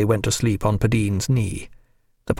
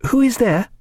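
high voice of a woman at the door; Clarissa's voice; 12 semitones higher than usual. A narrator's reading. Woman answering a late knock through chained door, high questioning voice. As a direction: call out the question in a much higher voice. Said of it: "Clarissa's voice asked".

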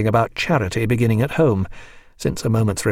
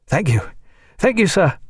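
eager thanks, second one higher; called out thanks; 8 semitones higher than usual. A narrator's reading. Lieutenant calling thanks after the departing doctor, higher and eager. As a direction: call it after him up the stairs. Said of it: "called Tom after him".

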